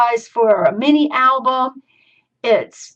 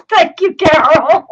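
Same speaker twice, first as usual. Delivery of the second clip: laughing, louder, higher thanks to Carol; 6 semitones higher, 7 dB louder than usual. Real, not acted. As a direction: giggle out the thanks. Laughing thanks to store owner.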